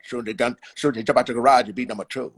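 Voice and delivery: low, fast, underbite mumble; lightly voiced, mumbled Bundini; Bundini Brown impression. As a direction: talk through a jutting underbite like Bundini. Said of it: "He had that underbite, and he said".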